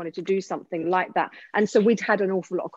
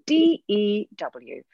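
very slow letter-by-letter spelling; deliberate, spaced-out letters; spelled out; much slower than usual. Shown as a demonstration. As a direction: spell it out slowly and distinctly. Spelling out the word.

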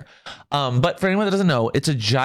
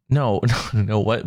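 normal talking voice

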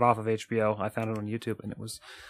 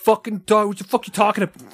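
hammed-up furious yelling, much higher; mock angry outburst; 8 semitones higher, 4 dB louder than usual. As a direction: bark it like an outraged friend. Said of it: "I haven't gotten a single, like".

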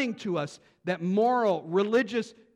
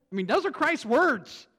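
ordinary delivery

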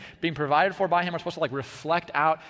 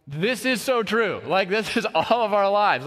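higher, louder exclamation of recognition; amused agreement; 6 semitones higher, 5 dB louder than usual. Retold or imitated. Audience laughing as they recognise their own lives, mock-amazed. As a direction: voice the crowd's delighted agreement. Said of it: "they're like".

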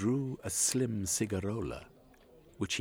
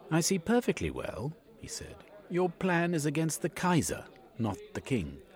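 raised, knowing gentleman; shrewd Cornucope; 9 semitones higher, 3 dB louder than usual. A narrator's reading. Cornucope tells Yegman the plan targets the Kaiser. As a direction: show you've seen through it.

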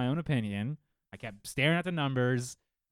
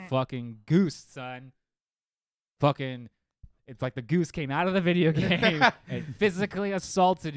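loud, higher, indignant rant; real exasperated outburst, louder and higher; outraged rant; 4 semitones higher, 8 dB louder than usual. Real, not acted. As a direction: vent like it's a personal assault. Ranting about goose player.

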